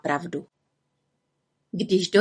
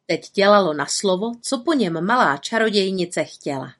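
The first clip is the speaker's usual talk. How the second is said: ordinary delivery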